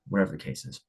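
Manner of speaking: normal talking voice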